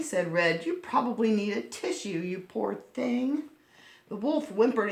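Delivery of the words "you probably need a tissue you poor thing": put-on tender little girl. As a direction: comfort a sick granny gently. Red tender to Granny.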